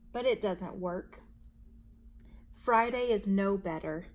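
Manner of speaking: plain reading voice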